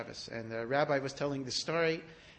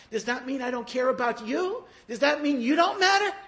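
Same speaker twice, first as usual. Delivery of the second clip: rising, impassioned plea, louder and higher; pleading; 8 semitones higher, 8 dB louder than usual. Acted. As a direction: build the plea to a peak. Chafetz Chaim pleading with expelled boy.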